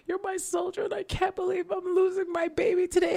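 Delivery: high, tearful farewell to the car; crying as the car is towed away; tearful goodbye. Retold or imitated. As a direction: choke up saying goodbye to your car. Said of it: "I'm crying and I'm like".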